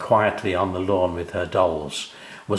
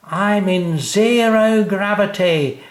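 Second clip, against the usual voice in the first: high, loud, slow spaceman voice; 11 semitones higher, 5 dB louder, slower than usual. A narrator's reading. Sam explaining to sister, slow astronaut voice. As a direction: play a slow-motion astronaut. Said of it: "he explained".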